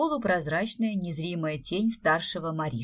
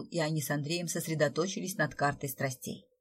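usual speaking voice

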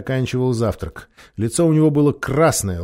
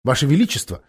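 offended; 6 semitones higher, 6 dB louder, faster than usual. A narrator's reading. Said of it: "обиженно произнёс Глозель".

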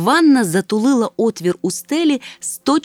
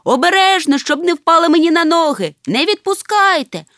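commanding; 6 semitones higher, 5 dB louder than usual. A narrator's reading. Father directing friends lifting the bathtub, loud commands. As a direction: drive the crew on as they haul. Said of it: "командував тато мумітроля".

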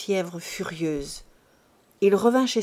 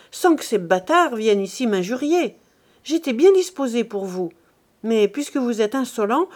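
angry threat; 3 semitones higher, 4 dB louder than usual. Acted. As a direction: throw him out with your voice. Pierre threatens his brother.

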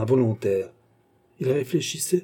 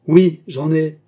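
loud, high snap back; forceful, high admission of temper; angry retort; 5 semitones higher, 9 dB louder, slower than usual. A narrator's reading. Furious Beausire cuts in.

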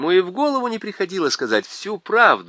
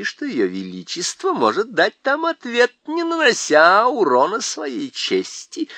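higher, eager faun pitch; 7 semitones higher than usual. A narrator's reading. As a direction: faun scheming with the king. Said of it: "продолжал фавн".

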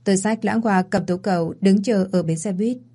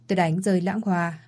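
ordinary delivery, nothing marked

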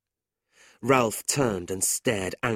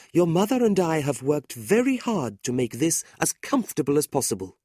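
fussing landlady; 6 semitones higher, 3 dB louder than usual. A narrator's reading. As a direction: fuss over him like a kindly aunt. La Creevy shows the house.

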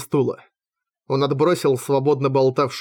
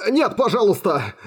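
high, desperate begging; frightened captive's plea; pleading; 6 semitones higher than usual. A narrator's reading. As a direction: beg for your life. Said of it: "взмолился бродяга".

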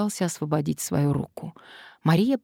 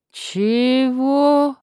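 astonished; 9 semitones higher, 7 dB louder than usual. Acted. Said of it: "удивлённо вытаращил на неё глаза".

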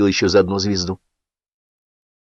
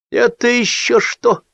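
getting worked up; 11 semitones higher than usual. A narrator's reading. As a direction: rant as he warms to it. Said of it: "разошёлся Сапфир".

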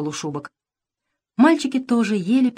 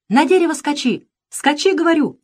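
high, urgent yell; desperate shout; 8 semitones higher, 4 dB louder than usual. A narrator's reading. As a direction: yell a frantic order. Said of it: "вдруг закричал отчаянно". (отчаянно кричит)

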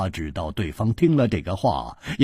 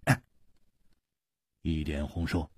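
usual speaking voice

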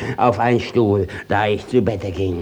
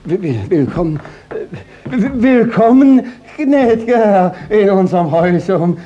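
flustered stammer; 9 semitones higher than usual. Acted. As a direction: trip over the welcome in panic. Judge flustered greeting high visitor. (stotternd und überrumpelt)

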